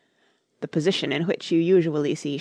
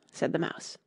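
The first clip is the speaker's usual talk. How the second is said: plain reading voice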